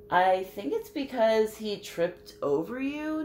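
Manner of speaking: plain reading voice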